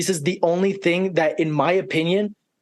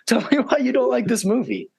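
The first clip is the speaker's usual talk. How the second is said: ordinary talk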